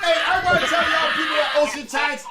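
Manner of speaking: high, loud laughing call-out; calls out the staff who disbelieved him, shouting. Real, not acted. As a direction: yell it out between laughs.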